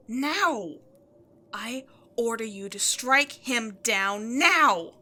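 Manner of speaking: loud angry command; furious order. Acted. Demon queen ordering her minion, furious shout. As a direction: bark the order, losing patience.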